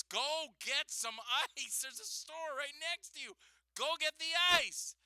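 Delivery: exasperated order. Retold or imitated. Retold telling worker off.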